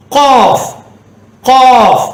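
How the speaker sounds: காஃப் as a rounded 'qoof'; heavy, held Arabic Qaaf for காஃப்; deep throat q. Shown as a demonstration. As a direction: make the k of காஃப் far back in the throat. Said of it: "தொண்டையில் வரக்கூடியது".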